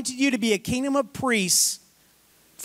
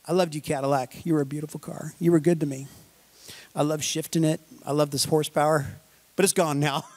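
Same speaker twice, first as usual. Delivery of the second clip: mock fond farewell; 4 semitones lower than usual. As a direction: bid the Cadillac a tender mock farewell. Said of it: "I'm like".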